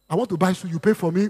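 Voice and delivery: ordinary talk, nothing marked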